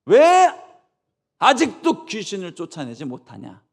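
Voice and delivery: a sudden high, loud why; angry yell. Acted. Jesus shouting at the disciples, angry yell. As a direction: explode at them.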